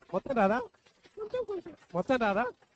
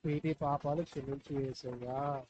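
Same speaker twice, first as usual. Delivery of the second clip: put-on slow, softer prayer line for the child to repeat; leading a prayer; 6 semitones lower, 5 dB quieter, slower than usual. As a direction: pray it slowly so the child can follow. Adult leads child in prayer. (నెమ్మదిగా, మెత్తగా ప్రార్థన)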